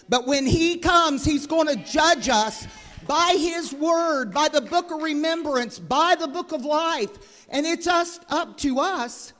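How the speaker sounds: loud preaching, slowing on each phrase; preaching at full force. Acted. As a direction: thunder it to the church like a revival preacher. Preacher building to loud climax.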